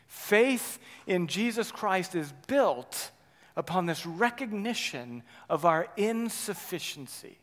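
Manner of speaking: usual speaking voice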